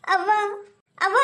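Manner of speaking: daughter sobbing out for her mother; crying. Acted. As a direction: call for mother, breaking into sobs. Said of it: "ಯಾಕವ್ವ ಅಳ್ತಿ?". (ಅಳುತ್ತಾ ಅವ್ವನನ್ನು ಕರೆಯುವುದು)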